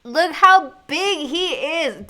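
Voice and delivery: high, loud, slow marvelling; awed exclaim. Real, not acted. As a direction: stretch it out in amazement. Marvelling at the huge rabbit.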